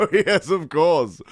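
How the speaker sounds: normal talking voice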